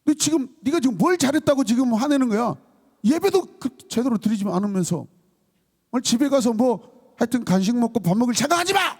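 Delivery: high-pitched mock telling-off, building to the threat; angry parent. Parent threatening the child with no dinner, in indignant scolding. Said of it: "여러분, 화날까요".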